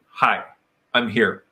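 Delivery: low, composed greeting; competent, open hello. Acted. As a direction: simply announce yourself, calm and low. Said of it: "but a very competent six-year-old".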